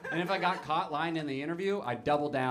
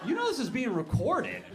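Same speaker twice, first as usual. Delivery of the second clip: high-pitched disbelief; incredulous interviewer; 9 semitones higher than usual. Retold or imitated. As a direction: ask it as if you can't believe what you heard. Interviewer voiced, astonished at answers.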